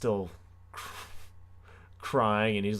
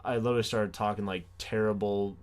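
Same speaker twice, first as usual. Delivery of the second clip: ordinary talk, nothing marked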